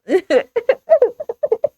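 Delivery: high burst of laughter. Real, not acted. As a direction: crack up at the memory. Laughing at the memory.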